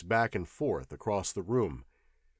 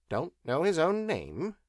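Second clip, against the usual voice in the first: higher incredulous echo; puzzled professor; 7 semitones higher than usual. Acted. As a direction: repeat it in disbelief. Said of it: "he repeated".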